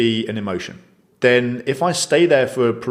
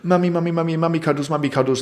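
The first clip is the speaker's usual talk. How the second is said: put-on higher-pitched little child calling for mummy; imitated pleading toddler; 6 semitones higher, faster than usual. Overwhelmed toddler begs mummy for cuddles, imitated in a raised voice. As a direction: repeat it like a toddler wanting cuddles. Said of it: "And so he goes".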